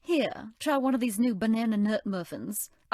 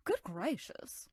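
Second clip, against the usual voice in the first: slow whispered "Good gracious"; 8 dB quieter, slower than usual. Acted. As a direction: breathe "Good gracious" in hushed alarm. Alarm at oven fire.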